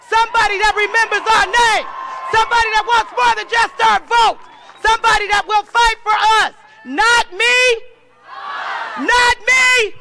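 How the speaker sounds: shouted rally chant; rally speech. Acted. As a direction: chant it with the crowd.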